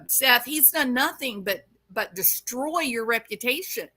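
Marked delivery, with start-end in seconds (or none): none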